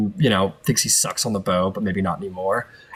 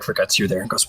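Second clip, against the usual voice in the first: ordinary delivery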